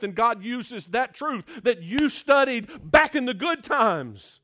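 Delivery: loud, high preacher's climax; preacher pleading with his church, rising to a loud climax; full-force preaching. Acted. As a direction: drive it home to the congregation.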